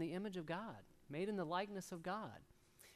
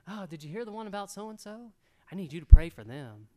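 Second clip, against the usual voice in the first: mock pious gossip; 16 dB louder than usual. Gossip disguised as prayer.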